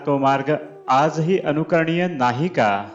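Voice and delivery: ordinary talk, nothing marked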